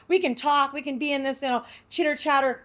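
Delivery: animated imitation of bubbly banter; lively chitter-chatter. Acts out chatty comedy mindset.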